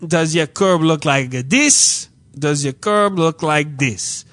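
put-on slow, lightly accented Caribbean clerk. Clerk showing a customer a cartoon, slow Caribbean imitation. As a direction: imitate a Caribbean official, drawing out each word. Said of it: "like, Caribbean dude who's kinda".

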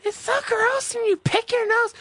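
very high, mincing mock voice of a disgusted person; high-pitched parody of someone calling you gross; mocking a disgusted critic. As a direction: squeal it like a prissy complainer mocking you. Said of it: "anybody who would call you fucking gross or say".

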